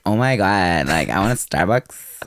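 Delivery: put-on lightly done Valley Girl voice; Valley Girl accent. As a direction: drawl it like a Valley Girl wanting Starbucks. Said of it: "Is that like Valley Girl?".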